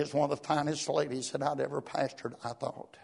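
ordinary talk, nothing marked

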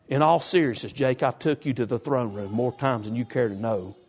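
usual speaking voice

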